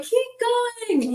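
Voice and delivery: very high, retold panicky cry; shocked urging. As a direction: imitate urging the driver on in shock. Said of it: "I was in such shock".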